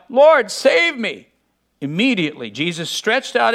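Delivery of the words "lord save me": higher, drawn-out cry for help; narrated desperate plea, moderately raised. A narrator's reading. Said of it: "he cried out".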